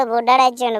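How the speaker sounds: ordinary talk, nothing marked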